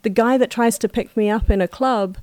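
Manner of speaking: usual speaking voice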